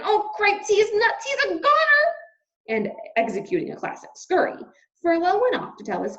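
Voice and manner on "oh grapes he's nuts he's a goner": high, loud squeaky panic; frantic high mouse squeal; panicked mouse. Acted. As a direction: squeal it in alarm. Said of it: "shouted Furlough again".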